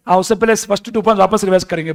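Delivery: ordinary talk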